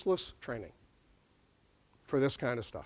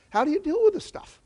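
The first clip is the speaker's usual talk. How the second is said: asked much higher, rising at the end; high rising question; 11 semitones higher than usual. Shown as a demonstration. Lecturer pushing the audience, question pitched high and rising.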